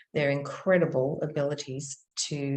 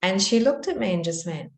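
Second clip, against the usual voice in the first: ordinary delivery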